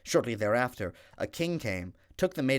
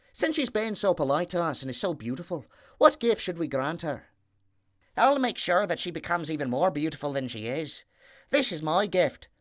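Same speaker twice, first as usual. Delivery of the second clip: higher, louder, lightly voiced little men; 6 semitones higher, 4 dB louder than usual. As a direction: plot kindly as the three little men. Little men discuss gifts.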